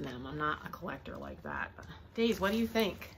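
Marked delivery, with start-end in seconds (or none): none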